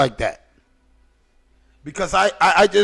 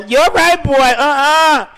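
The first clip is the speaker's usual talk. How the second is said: put-on high, loud older lady voice; hammed-up, high-pitched auntie voice; imitated older women; 7 semitones higher, 4 dB louder, slower than usual. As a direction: mimic an older woman exclaiming over a young man. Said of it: "they look at me and go".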